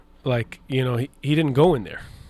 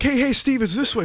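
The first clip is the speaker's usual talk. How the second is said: higher, bright mock assistant calling Steve; a lightly higher voice, waving him over; 12 semitones higher, 3 dB louder than usual. Assistant calling Steve toward the green room, higher voice.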